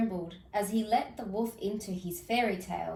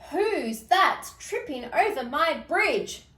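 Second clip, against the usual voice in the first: higher, louder roar; roaring troll; 6 semitones higher, 5 dB louder than usual. Acted. Troll guarding his bridge, loud roar. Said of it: "roared the troll".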